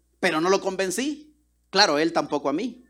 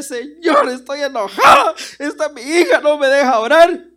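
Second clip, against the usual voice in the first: higher, louder mock-angry cry to God; pretend angry prayer; 7 semitones higher, 9 dB louder than usual. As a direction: shout your anger at the Lord. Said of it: "hubiera agarrado las almohadas".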